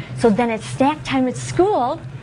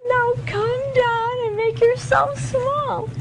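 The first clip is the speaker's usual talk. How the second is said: high, slow, tiny voice; squeaky little voice, drawn out; high small voice; 12 semitones higher, slower than usual. Shown as a demonstration. Shrinking voice for small.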